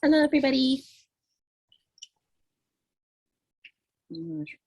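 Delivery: talking through a mouthful of food; mouth full. Real, not acted. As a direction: speak around a bite of food. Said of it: "I hate talking with something in my mouth".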